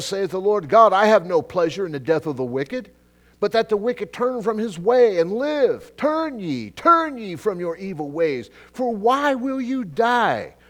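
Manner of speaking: loud, high pleading scripture, slow at the end; fiery preaching. Acted. Preacher reading Ezekiel to the congregation, fiery pleading. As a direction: plead God's words to the sinners.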